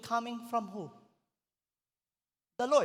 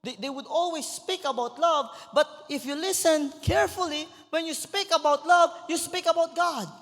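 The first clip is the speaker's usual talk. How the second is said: raised, loud preaching voice climbing higher; full-force preaching; 8 semitones higher, 7 dB louder than usual. Acted. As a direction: preach it out to the whole congregation. (pastor preaching to the church, loud and high)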